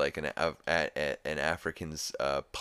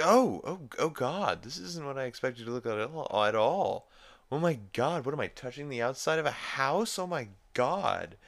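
mock-horrified, higher-pitched woman; horrified reaction; 8 semitones higher than usual. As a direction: act out appalled disbelief, pitched up. Acting blind woman's horrified reaction.

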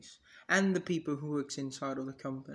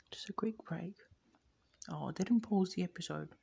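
real hushed whisper; whispering a quick break to the listeners; 5 dB quieter than usual. Real, not acted. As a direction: drop to a breathy aside whisper. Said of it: "Why am I whispering?".

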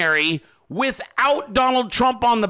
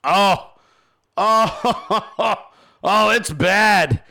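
laughing through the words; 3 dB louder, much slower than usual. Real, not acted. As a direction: laugh it out, amused. Laughing at the result.

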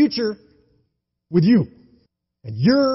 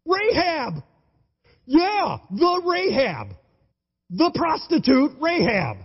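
high, exclaimed name; excited reveal; 8 semitones higher, slower than usual. Real, not acted. Preacher revealing Rahab to the congregation, excited and high. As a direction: land the name like a shocking twist.

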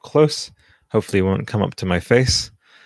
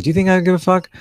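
defiant hype; 9 semitones higher, 5 dB louder, faster than usual. Real, not acted. Rhetorical hype question.

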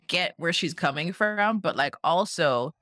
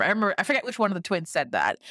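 ordinary talk, nothing marked

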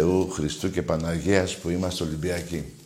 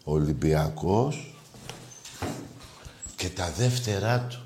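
slow savoring; 3 semitones lower, 7 dB quieter than usual. Shown as a demonstration. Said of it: "Ο [name] πιο ωραία".

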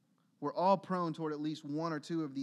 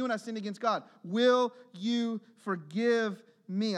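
rising, louder preacher's exhortation; fervent preaching; 5 semitones higher, 5 dB louder than usual. Real, not acted. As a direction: press the congregation hard, building. Preaching at full force again.

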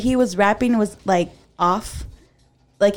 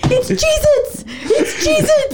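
high laughing squeal; excited shout; slower than usual. Retold or imitated. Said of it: "And then he goes".

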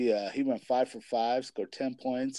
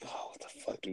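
whisper; 13 dB quieter, faster than usual. Retold or imitated. Panicked parent retelling midnight worry.

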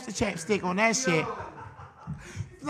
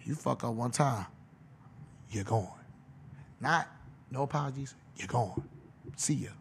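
much lower, softer stern coach voice; Bill Belichick; 8 semitones lower, 11 dB quieter than usual. Retold or imitated. Head coach warning a new signing, low and quiet. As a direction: drop low and quiet like a strict head coach laying down terms. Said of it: "Bill Belichick saying".

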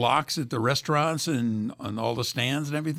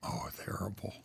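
usual speaking voice

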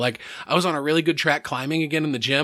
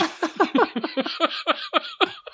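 high rising burst of laughter. Real, not acted. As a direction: let the laugh rise up high. Laughing at the memory.

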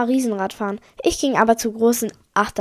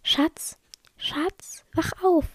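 lightly acted, high desperate plea; 7 semitones higher, 3 dB quieter, much slower than usual. A narrator's reading. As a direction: plead softly for someone to wake up. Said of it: "Ich hörte eine verzweifelte Stimme".

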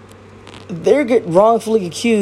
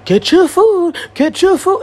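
higher, louder mocking voice of the man; imitating the man; 10 semitones higher, 3 dB louder, slower than usual. Mocking the man who argued with the lady, higher and louder. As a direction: throw his words back at him, higher and louder. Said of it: "and he's".

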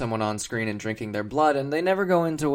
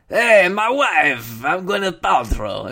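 put-on loud, high, drawn-out Borat-like accent; hammed-up thick Transylvanian accent, belted out; Gwyneth Paltrow; 6 semitones higher, 8 dB louder, slower than usual. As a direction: do a bad Borat as mock Transylvanian. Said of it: "a Borat impression".

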